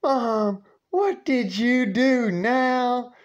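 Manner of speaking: high, slow, drawn-out weary question; exasperated son. Retold or imitated. Son repeats his question to his mother, weary and high. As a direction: stretch it out like you've seen this before.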